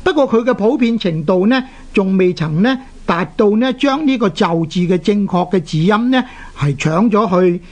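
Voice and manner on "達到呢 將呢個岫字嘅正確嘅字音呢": ordinary talk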